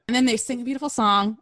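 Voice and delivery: ordinary delivery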